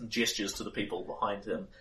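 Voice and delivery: normal talking voice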